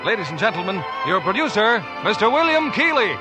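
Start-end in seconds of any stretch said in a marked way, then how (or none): none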